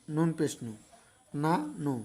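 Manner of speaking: usual speaking voice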